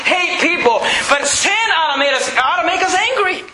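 high, insistent sermon delivery; forceful preaching. Real, not acted. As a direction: drive each phrase home to the congregation.

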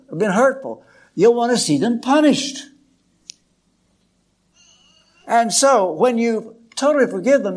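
fervent preacher climax; preaching. Acted. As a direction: drive it home from the pulpit. Preaching at full force.

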